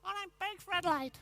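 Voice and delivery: put-on a small boy mumbling back, pitched high; little kid. Editor hears the kid refuse, high child voice. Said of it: "Little kid says".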